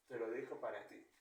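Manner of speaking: normal talking voice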